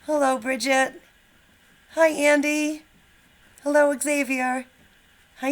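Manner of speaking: ordinary talk, nothing marked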